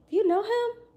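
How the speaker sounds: high-pitched questioning voice; curious lady. Retold or imitated. Lady asking her about a man, high and curious. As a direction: ask it high, rising in surprise. Retold lady's question to speaker.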